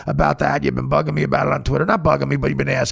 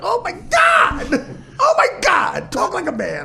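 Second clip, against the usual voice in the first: mock outrage; slower than usual. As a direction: squeal in horror at your friend. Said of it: "I'm like".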